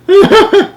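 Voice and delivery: short, high burst of real laughter; amused laugh. Real, not acted. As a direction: laugh at the joke. Reader laughs at the Jack joke.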